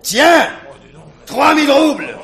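put-on loud drunken taunt. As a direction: shout the offer out. Slamming money down.

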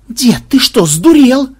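high, loud incredulous shout; yelling. A narrator's reading. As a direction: yell in alarmed disbelief. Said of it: "завопил Ксенобайт".

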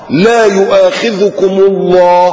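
slow, chanted recitation; Quran recitation. Acted. Preacher recites verse slowly to congregation. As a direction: intone the verse slowly and reverently.

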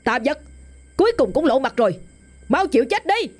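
high, fierce battle cry; commanding shout. Acted. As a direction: challenge the demon. Exorcist challenges demon.

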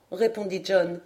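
ordinary delivery, nothing marked

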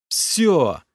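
exasperated exhale. A narrator's reading. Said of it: "выдохнул я".

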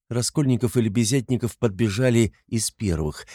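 ordinary delivery, nothing marked

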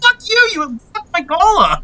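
high-pitched, hammed-up angry rant; exaggerated squawk of indignation; mock outburst. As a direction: replay your outrage way up high. Retelling his rage at call survey.

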